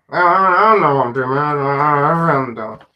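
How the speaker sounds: hammed-up whiny crying, loud at first; mock wail. Streamer lamenting no plan for new videos, mock sobbing. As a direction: pretend to break down in helpless despair.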